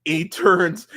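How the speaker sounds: ordinary talk, nothing marked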